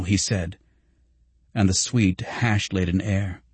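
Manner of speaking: ordinary delivery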